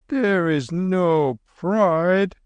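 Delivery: put-on high, slow gentle old monk; old lama voice.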